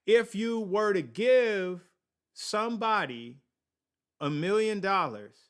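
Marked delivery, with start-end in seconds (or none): none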